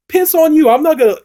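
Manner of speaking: high, loud mocking snap; hammed-up defiant wife; funny defiant retort. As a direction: spit it back at him, cheeky and loud. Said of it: "it's kind of funny the way she says it".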